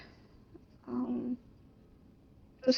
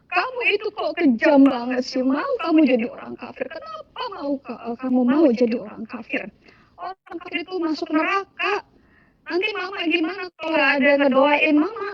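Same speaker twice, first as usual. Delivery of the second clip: higher, plaintive mock wail; imitated tearful mother; 4 semitones higher than usual. Crying mother pleading with child who leaves Islam, imitated tearful wail. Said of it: "dia cuma bisa nangis".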